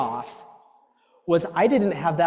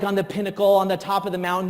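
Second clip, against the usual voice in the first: ordinary delivery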